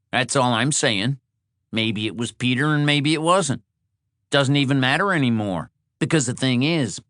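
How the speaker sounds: pushy guest laying down his case; argumentative Shorty. Acted. Shorty argues with Mark.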